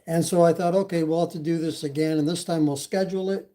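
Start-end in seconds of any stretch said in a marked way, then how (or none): none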